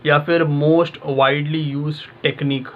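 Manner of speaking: slow, drawn out; deliberate dictation pace; slow, spelled-out example question. Shown as a demonstration. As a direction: say it slowly, like a quiz question. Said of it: "आप इस तरीके से सोचते हो ना".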